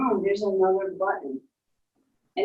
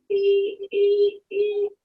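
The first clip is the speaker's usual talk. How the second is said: high mock alarm beeps; beeping siren; 11 semitones higher, much slower than usual. Storyteller imitates the planet's siren for the child, high beeps. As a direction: beep out the alarm like a machine. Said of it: "hear that siren again".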